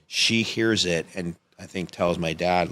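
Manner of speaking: ordinary talk, nothing marked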